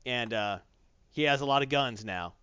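plain reading voice

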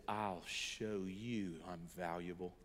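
quiet vow. Retold or imitated. Hurt boy's vow after crying.

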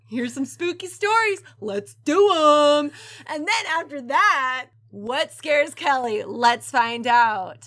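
loud, hyped trailer announcer; announcer hype. Acted. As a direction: sell it like a promo.

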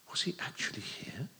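unvoiced fearful thought; whisper. A narrator's reading. Boy fearing husband is home, whispered. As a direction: hush it as a frightened thought.

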